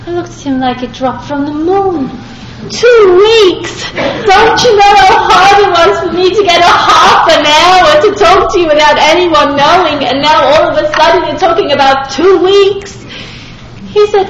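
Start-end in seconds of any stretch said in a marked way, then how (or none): 2.7-13.0 higher and louder, half shouting in disbelief; incredulous outburst. Retold or imitated. Speaker shouting disbelief at the rabbi's two-week suggestion. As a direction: blurt it out like the idea is absurd.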